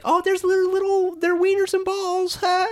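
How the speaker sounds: high-pitched put-on female voice; imitated ultrasound lady. Technician points out babies' genitals, mock high voice. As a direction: coo it high, mocking the technician.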